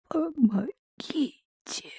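weak, barely audible cry for help; a thin high plea, almost no breath; faint plea for help. Acted. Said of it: "с еле слышной мольбой".

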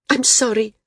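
high, urgent apology; blurted apology. A narrator's reading. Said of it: "Srella blurted".

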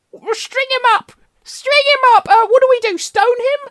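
high, loud lynch-mob shout; mob cry. Pretend. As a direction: whip up the crowd, shrill and loud. Angry mob cry, +22 semitones.

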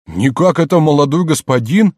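high, loud, flustered question; bewildered. Acted. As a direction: recognise your master in confusion. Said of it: "растерянно сказал Айске". (растерянно, с изумлением)

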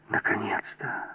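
whispered relief mixed with fear; hushed, relieved exhale; relieved sigh. A narrator's reading. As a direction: let it out on a soft breath. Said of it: "Жанна облегчённо вздохнула".

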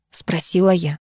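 ordinary delivery, nothing marked

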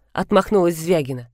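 ordinary delivery, nothing marked

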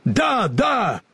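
high, emphatic exclamation; fervent agreement. A narrator's reading. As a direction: agree ardently, pitch leaping up. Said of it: "горячо подхватил железный дровосек".

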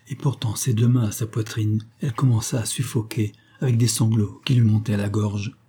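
plain reading voice

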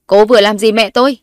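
loud furious demand; husband shouts at his wife; angry shout. A narrator's reading. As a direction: bark it at her. Husband charges at her.

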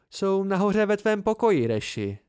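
bright, clear call-out. A narrator's reading. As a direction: speak up clearly to a friend. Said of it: "ozval se Bast jasným hlasem".

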